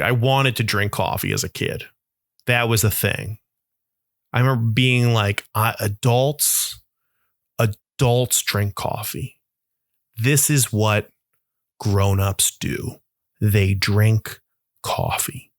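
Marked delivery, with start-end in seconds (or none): 5.5-15.5 slow, halting pronouncement on grown-ups; awed slow recital. Retold or imitated. Kid marvelling at coffee-drinking adults, slow awed recital. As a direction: weigh each word like a big revelation. Recalls his childhood awe of adults.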